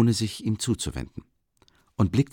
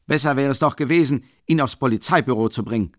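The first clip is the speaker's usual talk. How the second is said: higher, louder, fast complaint; townsman insisting; 6 semitones higher, 5 dB louder, faster than usual. A narrator's reading. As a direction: press the point. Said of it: "meinte der Kleinbürger".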